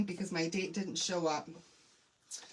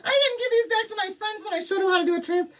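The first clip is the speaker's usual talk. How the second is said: quick, high, laughing fluster; rattling it off in a fluster; flustered rush; 10 semitones higher, 5 dB louder, faster than usual. Real, not acted. Realizes she kept friends' transfers.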